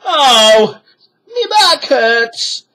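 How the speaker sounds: very high, loud pained pirate; cried out. A narrator's reading. Pirate moans about digging, high loud cry. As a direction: yelp and complain. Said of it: "Stinky cried".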